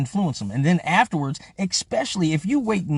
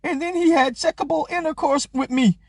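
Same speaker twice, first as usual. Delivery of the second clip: high, put-on mocking woman's voice; mocking the accuser; 9 semitones higher than usual. Mocking a woman reading her statement, high put-on voice. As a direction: mimic her reading a statement, much higher. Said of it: "she reading this shit off a piece of paper".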